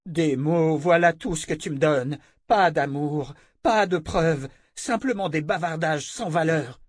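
high, hard, demanding voice; commanding woman. A narrator's reading. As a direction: order him about coldly. Callan contemptuous.

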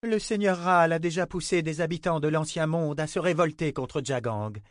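voix de guerrière haute, pressante; voix de femme. Acted. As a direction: plaide avec ferveur devant la foule. Nietzsche plaide devant les soldats. (voix de guerrière fervente)

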